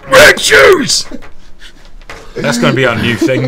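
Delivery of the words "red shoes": very high, loud shout; sudden outburst. Acted. Host imagines someone sitting then blurting, high shout. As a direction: blurt it out of nowhere at full pitch. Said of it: "Imagine just sat there and you say".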